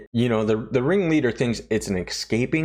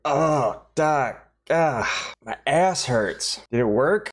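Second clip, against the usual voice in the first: groaning Ulrich; 5 semitones higher, slower than usual. Retold or imitated. Ulrich waking from surgery in pain.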